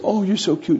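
high, gushing puppy talk; imitated cooing. Girl to the puppy on her hand, imitated cooing. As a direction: coo it as if melting over a puppy.

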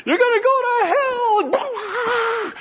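high, slow, screaming doom; mock hellfire scream. Street preacher warning college students, screamed high and slow. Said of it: "He's just screaming at all the students".

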